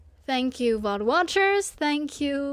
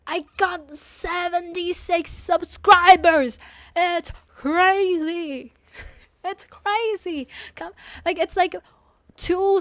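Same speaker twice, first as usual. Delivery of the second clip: excited; 7 semitones higher, 6 dB louder than usual. Real, not acted. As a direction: marvel at the number. Excited about subscriber count.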